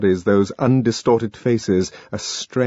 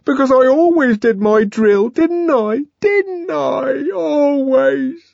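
very high, loud tearful pleading; sobbing plea; 4 dB louder than usual. A narrator's reading. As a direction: plead through the tears.

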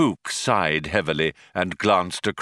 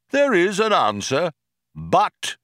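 lightly voiced computer, measured and raised; pondering computer; 9 semitones higher than usual. A narrator's reading. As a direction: confirm it with measured weight. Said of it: "said Deep Thought".

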